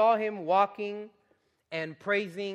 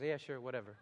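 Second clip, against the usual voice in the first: dismissive whatever; 6 semitones lower, 10 dB quieter than usual. Retold or imitated. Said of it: "I was just".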